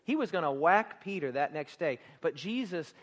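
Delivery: usual speaking voice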